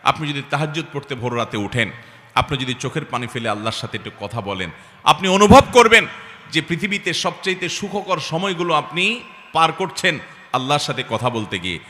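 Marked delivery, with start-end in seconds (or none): 5.0-10.1 sudden high, loud burst; impassioned preaching. Acted. Preacher promising joy in night prayer, impassioned preaching. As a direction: hurl the promise at the listeners.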